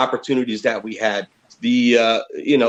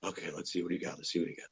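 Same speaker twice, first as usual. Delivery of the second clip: muttered aside; 10 semitones lower, 20 dB quieter, faster than usual. Retold or imitated. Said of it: "I said".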